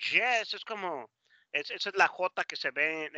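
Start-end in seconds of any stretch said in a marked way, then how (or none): none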